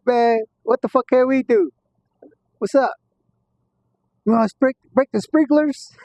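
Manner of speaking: high, eager, conspiratorial ask; excited scheming. Retold or imitated. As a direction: pitch it up like a dare. Friend proposing a scheme.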